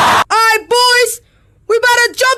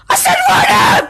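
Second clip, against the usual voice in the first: shout. Acted. Shouted order repeated.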